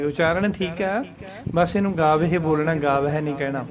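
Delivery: normal talking voice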